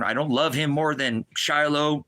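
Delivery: ordinary talk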